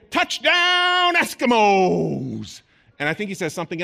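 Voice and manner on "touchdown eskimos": long stretched triumphant yell, much higher and louder; sports commentator call. Retold or imitated. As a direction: call the touchdown like a stadium announcer. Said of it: "you're gonna hear the commentator say".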